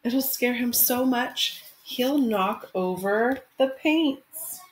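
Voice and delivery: gentle picture-book read-aloud; expressive storytime narration; reading to children. Acted. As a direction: read it like storytime for your class. Teacher reads picture book aloud.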